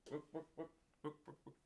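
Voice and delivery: high clucking hen going book book book; chicken clucking. Acted. Chicken in the park.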